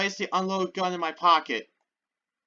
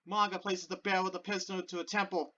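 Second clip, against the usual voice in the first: ordinary delivery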